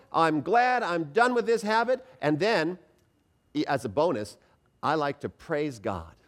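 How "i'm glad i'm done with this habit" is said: glad, higher-pitched declaration; joyful. Shown as a demonstration. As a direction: say it with joy, meaning it. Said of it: "with a joy".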